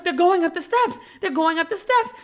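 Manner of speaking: high, loud alarmed mom voice; imitating a frantic mother; panicked mom. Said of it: "she was like".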